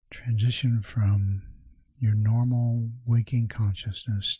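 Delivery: measured, soothing meditation guide; guided meditation. Acted. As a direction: ease the listener into calm, leaving space after each phrase.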